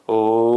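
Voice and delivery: ओ held long and low; prolonged O. Shown as a demonstration. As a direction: stretch ओ into a pluta. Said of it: "ओ के उच्चारण को ही लंबा खींचा जाता है". (ओ को लंबा खींचकर)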